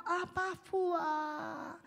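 slow, tearful plea, drawn out; crying prayer. Shown as a demonstration. Pastor shows someone who can't pray, tearfully. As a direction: let the voice break as you call. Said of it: "当你不知道怎么祷告".

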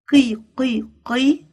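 "قي" repeated as a sounded-out syllable; qaf with kasra. Shown as a demonstration. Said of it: "قاف كسرة".

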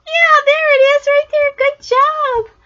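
high, loud praise; excited praise. Real, not acted. Grandma praising the child for spotting the butterfly. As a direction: cheer with delight.

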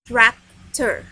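"Tractor" split into trac... tor; loud teacher's model of "Tractor", syllable by syllable; syllable demonstration. Shown as a demonstration. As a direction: break "Tractor" into two clear beats, loudly. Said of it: "Two syllables".

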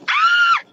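sudden very high loud scream. Retold or imitated. Woman reenacting screaming at a man at the bar, loud high scream. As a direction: scream at the unwanted touch. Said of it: "So I was like".

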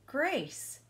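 excited high call of the name; shouted. Acted. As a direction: shout it out like a happy kid. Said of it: "shouted Ralph".

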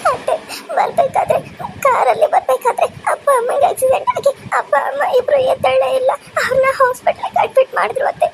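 high, crying account of the accident; tearful telling. Acted. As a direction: plead through tears. Girl recounts accident crying. (ಅಳುತ್ತಾ ಹೇಳುವುದು)

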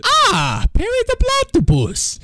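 high, fast cartoon-villain voice; a cartoon villain greeting his nemesis, high and fast; Doofenshmirtz impression. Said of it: "a really good Doofenshmirtz impression".

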